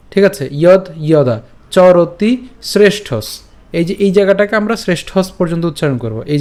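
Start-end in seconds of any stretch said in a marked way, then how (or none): 0.5-3.4 slow, word-by-word Sanskrit; broken-up recitation. Shown as a demonstration. Teacher shows the split verse, slow careful recitation. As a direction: draw out each word, then pause. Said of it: "শ্লোকটি ভেঙে দিয়েছি".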